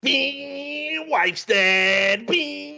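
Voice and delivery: high ringing sing-out with held bings; mock chime. Film fan gleefully recapping the wife's death, mock chime. Jingle-like quip in film retelling.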